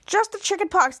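usual speaking voice

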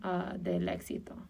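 usual speaking voice

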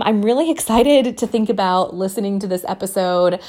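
normal talking voice

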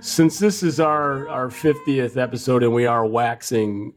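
ordinary talk

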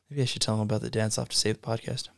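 muttered aside. Acted. Aside to self.

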